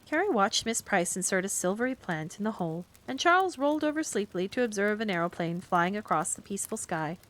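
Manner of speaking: plain reading voice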